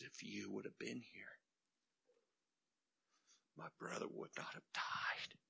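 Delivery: breathy whispered plea to Jesus; Mary quietly pleading with Jesus in a whisper; hushed plea. Acted. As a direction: whisper it like a broken-hearted prayer. Mary's plea read hushed.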